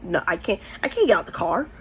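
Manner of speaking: usual speaking voice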